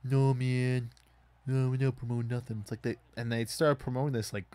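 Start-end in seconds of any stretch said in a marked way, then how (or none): 0.0-2.6 mocking the company. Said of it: "here they're like".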